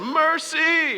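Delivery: very high, long-drawn yell; crowd yelling. Retold or imitated. Crowd turning on the bodyguard who dropped him, imitated yelling. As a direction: imitate a crowd hollering, stretched right out. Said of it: "They start yelling".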